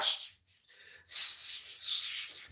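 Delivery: very soft, voiceless shushes; imitated shushing. Yoongi silencing Jen, imitated shushing. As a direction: hush someone with repeated separate shushes. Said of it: "he told Jen".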